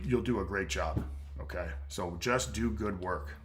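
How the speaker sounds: ordinary talk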